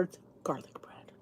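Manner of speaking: whispered aside. Real, not acted. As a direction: breathe it as a secret. Whispered aside about Timmy.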